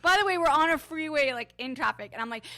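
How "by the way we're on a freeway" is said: excited. Real, not acted. Loud fast urgent aside.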